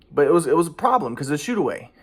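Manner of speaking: usual speaking voice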